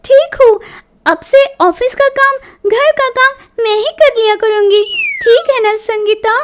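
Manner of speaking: loud, high, hurried-sounding promises; eager-to-please daughter-in-law. A narrator's reading. Daughter-in-law promising mother-in-law all chores, eagerly placating. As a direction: promise everything to avoid the stick.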